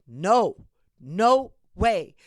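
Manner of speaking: loud, firm no no way; telling ICE off, a loud firm refusal. Pretend. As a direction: stand your ground and refuse. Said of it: "tell ice".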